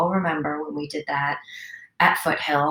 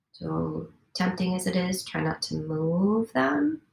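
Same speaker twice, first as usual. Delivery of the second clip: guided meditation; 3 semitones higher than usual. Acted. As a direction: coax them to stillness, toe by toe. Guides a body scan.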